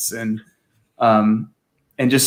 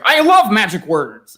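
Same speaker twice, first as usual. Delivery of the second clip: imitating Stan Lee; 6 semitones higher, 5 dB louder, slower than usual. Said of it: "And Stan Lee's like".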